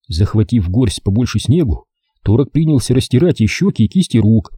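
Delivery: usual speaking voice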